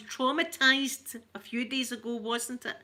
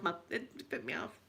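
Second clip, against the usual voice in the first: much softer, dropped-away "it put me off"; quiet emphatic repeat; 4 semitones lower, 9 dB quieter than usual. Real, not acted. Still put off by the seagull, repeated much softer. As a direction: murmur "it put me off" to yourself, softer.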